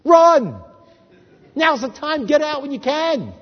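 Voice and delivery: high, loud desperate yell; urgent scream at the screen, begging him to run; imagined scream of warning. Retold or imitated. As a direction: shout a frantic warning to flee. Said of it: "I want to look at Yeshua and scream at him".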